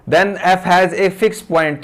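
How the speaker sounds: very slow, word by word, for note-taking; slow dictating teacher; slow dictation. Shown as a demonstration. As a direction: read it out slowly while students write. Dictating theorem for notes.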